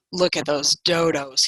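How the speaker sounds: low-pitched aside of a fox; scornful private thought. A narrator's reading. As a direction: think aloud in a low voice. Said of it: "he thought".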